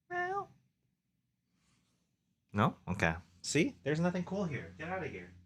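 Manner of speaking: high, soft coaxing voice to a cat; calling Dingus the cat inside in a high hush. Real, not acted. Talking to the cat Peach.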